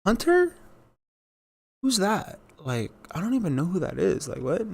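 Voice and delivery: squeaky innocent "who, me?" act; mock feigned ignorance. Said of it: "but they're like".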